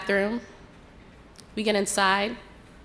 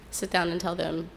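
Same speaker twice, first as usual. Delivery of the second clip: ordinary delivery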